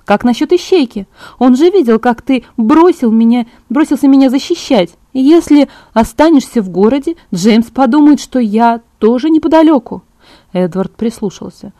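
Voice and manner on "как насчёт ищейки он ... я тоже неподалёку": higher, louder, insistent argument; lightly voiced Bella, indignant. A narrator's reading. Bella argues that the plan looks suspicious, indignant. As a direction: press the objection with rising emphasis.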